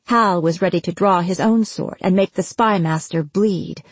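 ordinary delivery, nothing marked

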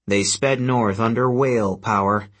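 usual speaking voice

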